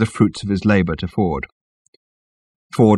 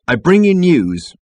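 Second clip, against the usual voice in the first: slow, raised proclamation; firm announcement; 9 semitones higher, 5 dB louder, slower than usual. A narrator's reading. Ford starts his announcement, firmly. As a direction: proclaim it slowly to the crowd. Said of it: "be a little stronger on the subject".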